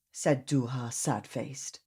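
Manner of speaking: ordinary delivery, nothing marked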